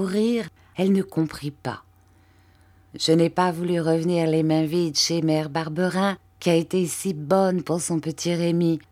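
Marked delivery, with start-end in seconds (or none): none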